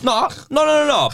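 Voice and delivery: high, shouted denial. Real, not acted. Protesting loudly.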